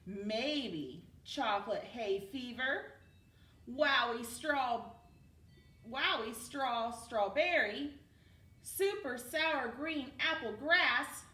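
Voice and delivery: each flavor drawn out slowly; slow savoring list. Acted. Cornelius pitching wild flavors to Tilly, slow and savoring. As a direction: dream up each flavor aloud.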